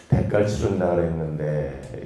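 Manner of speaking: put-on guilty child thinking on the way home; worried inner voice. Child worries about broken promise.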